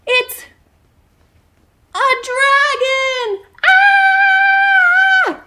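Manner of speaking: high, loud scream of alarm; panicked shout at the dragon; being chased. Acted. Dragon found, fleeing.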